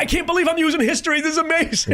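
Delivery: high, fast, amazed gush; excited awe. Retold or imitated. Awe flying over historic rivers.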